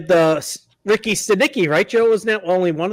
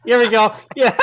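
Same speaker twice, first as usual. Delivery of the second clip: ordinary talk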